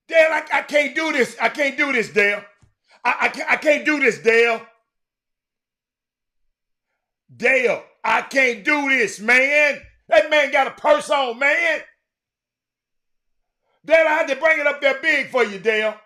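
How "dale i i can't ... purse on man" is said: loud, high, exasperated yelling; shouted comic outrage, high and loud. Real, not acted. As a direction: howl in comic disbelief at the purse. Mock outrage at Cowboys purse.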